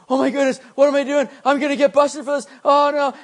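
high, loud panicked voice at the border; panicked friend. Retold or imitated. Imitating a friend panicking about getting caught at the border. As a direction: mimic a buddy freaking out about getting busted. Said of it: "he starts freaking out".

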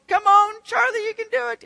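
high, loud mock cheer from the stands; hammed-up cheering spectator; cheering crowd. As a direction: cheer him on like a sports fan.

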